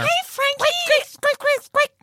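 high, squeaky rat chatter; squeaky rat greeting. Acted. Greeting Frank the rat in squeaks.

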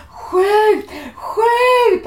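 high, loud urgent yell; re-enacted fan shout. Fan re-enacting watching Sweden play, urgent shouting. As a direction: holler it at the team. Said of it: "jag är helt inne i det".

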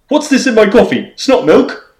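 loud, high put-on Cockney; Cockney accent. Host repeating the cryptic clue in a loud Cockney accent. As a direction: give it full mock-Cockney pitch. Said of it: "why did you have to have the Cockney accent for that".

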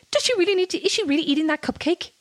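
high-pitched incredulous nitpicking; mock outrage. Griping about everything her nemesis does, mock outrage.

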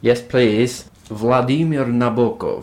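put-on 'Vladimir Nabokov' with a slow Russian accent, rolled and careful; slow Russian-accented name. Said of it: "People making fun of my Russian accent".

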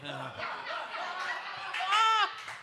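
high-pitched burst of laughter. Real, not acted. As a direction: crack up mid-story. Laughs at stretching story.

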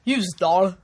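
badly faked Hälsingland drawl; Hälsingland accent. Shown as a demonstration. Attempting the requested dialect, Hälsingland accent. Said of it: "Hälsingmål".

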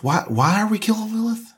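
high startled question; alarmed. Acted. JJ alarmed at the plan to kill Lilith, startled. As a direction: panic at the plan.

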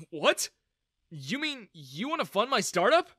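high, rising disbelief; astonished. A narrator's reading. Young founder to would-be investors, astonished. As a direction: ask it as if you can't believe your luck. Jaw dropped at investment offer.

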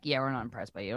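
low, soft deadpan rejection; imitating an underwhelmed judge; unimpressed. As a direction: dismiss them quietly, dropping pitch and volume. Retelling panel's unimpressed rejection.

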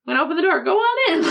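high, bouncy cartoon character; sing-song cartoon invitation. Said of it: "kinda like cartoons".

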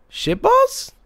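put-on very high little-kid question; sweet innocent child. Child innocently echoing mom's curse, sweet innocent voice. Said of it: "in the sweetest, most innocent voice".

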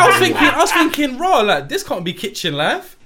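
high, loud laughing retelling. Retold or imitated. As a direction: giggle it out, thrilled.

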